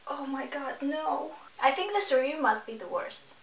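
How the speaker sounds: ordinary talk, nothing marked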